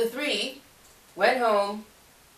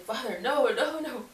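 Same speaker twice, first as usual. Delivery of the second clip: whiny begging; 7 semitones higher than usual. Retold or imitated. Begging God to keep cookies.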